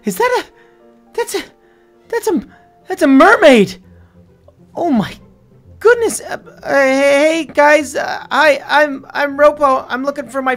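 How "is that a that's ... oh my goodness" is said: high, halting, then bursting out louder; stammer, then exclaim in astonishment; stunned amazement. Acted. Discovers a mermaid.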